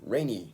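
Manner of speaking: Rainy said slowly and clearly for copying; model pronunciation. Shown as a demonstration. Teacher modelling Rainy for learners, clear model word. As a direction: say Rainy as a model for students to repeat. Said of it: "真似してください".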